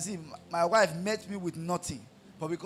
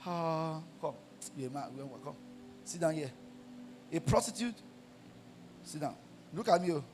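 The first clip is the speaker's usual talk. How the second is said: seductive prostitute; 6 dB quieter than usual. Retold or imitated. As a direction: coax the man to sit down beside you. Preacher acts a seductive prostitute.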